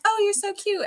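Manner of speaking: high-pitched gushing coo; cooing at pets. Retold or imitated. Gushing at pets while filming them.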